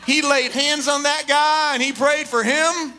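emphatic preaching. Real, not acted. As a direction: proclaim it with comic relish. Mock-preaching euphemism for a beating.

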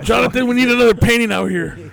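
loud, high barking order; mock boss voice. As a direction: shout it like a fussy boss.

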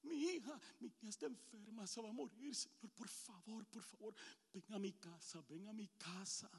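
ruego bajito y quebrado; súplica callada; súplica suave. Acted. Jairo begs for his dying daughter.

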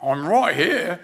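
put-on stretched English accent, without the shout; English accent echo. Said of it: "he was from England".

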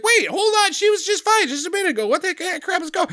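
very high, flustered disbelief; mock panic. Man reacting to grandma suddenly dying, high mock panic. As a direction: imitate someone thrown by sudden bad news.

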